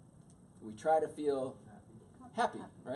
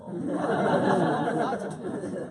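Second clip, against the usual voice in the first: slow, drawn out through a laugh, higher and louder; 6 semitones higher, 12 dB louder, much slower than usual. Shown as a demonstration. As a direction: stretch "Lots of things" out while laughing.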